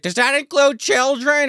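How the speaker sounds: high, slow hopeful question; hopeful woman's voice. Retold or imitated. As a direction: ask it hopefully. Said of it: "she's like".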